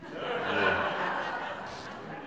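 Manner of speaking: soft breathy laugh at his own joke; real quiet laugh after the joke. Real, not acted. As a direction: chuckle quietly at the punchline.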